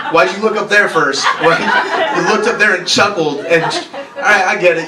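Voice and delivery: usual speaking voice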